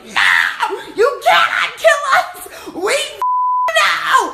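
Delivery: loud laughing yell of no; mock panicked shouting through laughter; retold scream. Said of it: "I just started screaming".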